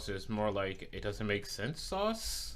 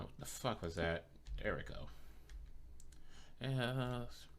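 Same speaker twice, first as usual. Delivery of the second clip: muttered to self; 3 semitones higher, 10 dB quieter than usual. Real, not acted. Streamer mutters to himself.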